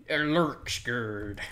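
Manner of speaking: slow, creepy lurker voice; playful slow spooky drawl; spooky lurking voice. Pretend. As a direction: draw it out like something lurking. Spooky talk about lurking mob.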